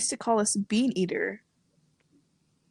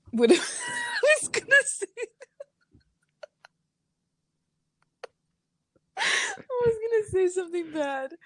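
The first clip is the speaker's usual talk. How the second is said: laughing while trying to talk; giggling through the words; 13 semitones higher than usual. Real, not acted. Laughing through embarrassed admission.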